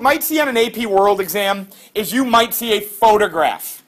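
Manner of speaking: normal talking voice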